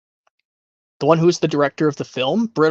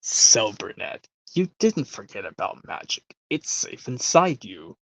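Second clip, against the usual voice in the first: softer, lower, hushed reassurance; a hint of a wise old conductor confiding a secret; gentle old conductor; 4 semitones lower, 5 dB quieter than usual. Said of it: "how about this one goes".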